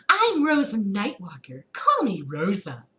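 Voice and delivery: ordinary delivery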